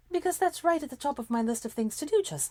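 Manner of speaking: sarcastic. A narrator's reading. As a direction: let the sarcasm drip lightly. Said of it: "she said sarcastically".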